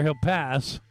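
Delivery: ordinary delivery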